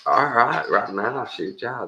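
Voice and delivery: high, quick eager yes; eager buyer. Retold or imitated. A hint of an impulsive buyer saying yes right away. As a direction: agree on the spot, a touch too eager. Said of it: "just goes".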